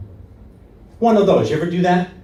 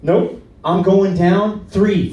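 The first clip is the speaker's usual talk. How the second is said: ordinary talk, nothing marked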